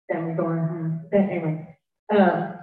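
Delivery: usual speaking voice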